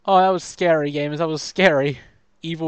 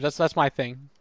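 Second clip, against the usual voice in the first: usual speaking voice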